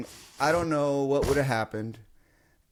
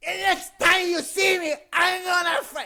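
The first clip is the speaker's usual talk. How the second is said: high-pitched imitation of a raging man; furious mock yelling. Storyteller replays the stranger's threat, mock yelling. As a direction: threaten in a livid high voice, cut off. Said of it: "within him yelling".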